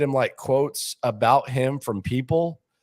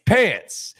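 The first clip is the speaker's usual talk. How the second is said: higher, louder Pants. falling flat; mocking deadpan; 7 semitones higher, 4 dB louder than usual. Needling the boss at dinner, high falling Pants. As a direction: repeat the word to needle him. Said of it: "You know how I get when I, like, take a word".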